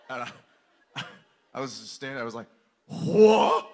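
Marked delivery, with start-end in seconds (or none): 2.9-3.7 high, loud disbelieving what; stunned what. Retold or imitated. Dad reacting to the no-pink complaint, stunned. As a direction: squeak out shocked disbelief.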